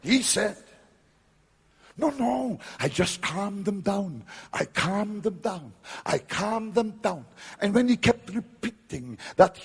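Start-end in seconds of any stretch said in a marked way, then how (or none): 2.0-7.2 put-on superior Oxford accent. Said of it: "very superior with an Oxford accent".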